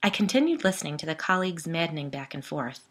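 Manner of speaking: ordinary delivery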